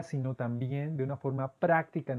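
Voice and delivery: ordinary talk, nothing marked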